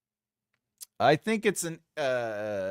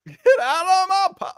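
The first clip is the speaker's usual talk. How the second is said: mock pub landlord shout; 12 dB louder than usual. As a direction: bellow like a landlord throwing someone out. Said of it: "shout out".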